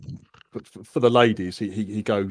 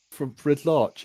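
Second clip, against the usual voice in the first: normal talking voice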